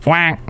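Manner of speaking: a light quacking noise; duck quack. Acted. Host imitates a duck, a light quack. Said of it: "what's that bird?".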